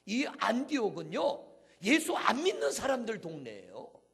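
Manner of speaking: high, booming sermon cry; impassioned pulpit shout; full-force preaching. Real, not acted. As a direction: proclaim it loudly, stretching the key words.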